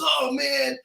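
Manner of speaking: high-pitched mock whine of protest; dismayed driver. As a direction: imitate a let-down driver, voice way up. Driver disappointed at the ticket.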